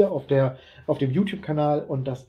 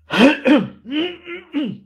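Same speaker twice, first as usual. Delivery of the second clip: loud, high throat clearing; 13 semitones higher, 5 dB louder than usual. Real, not acted. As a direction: clear the throat hard again. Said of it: "alleine durch diesen Räusperer".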